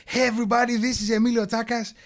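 high-pitched imitation of a video intro; over-eager video host. YouTuber mimicking their own first video intro, high and overdone. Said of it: "I'm watching the camera and I'm like".

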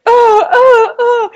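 high, loud mock cries; exaggerated high "oh" sobs; imitated wailing. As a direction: wail like the bullied friend, hammed up. Imitating bullied friend's wailing.

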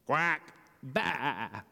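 quack, then a bleat; duck and sheep noises. A narrator's reading. Animals scramble into truck.

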